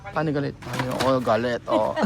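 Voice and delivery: low, quiet mock-angry voice; demonstrating angry. Shown as a demonstration. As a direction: show a child anger in a low growl. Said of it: "yung, yung nagagalit".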